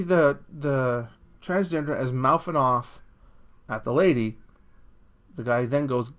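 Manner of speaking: normal talking voice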